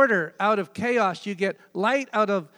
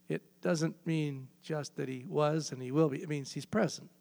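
ordinary delivery

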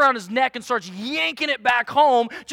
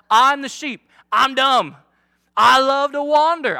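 loud confession; 7 dB louder than usual. Real, not acted.

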